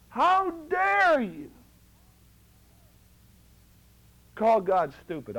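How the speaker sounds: slow, stretched outrage on How dare you; indignant rebuke. Retold or imitated. Preacher rebuking a self-doubting brother, indignant and slow. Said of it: "I said it that way to one man".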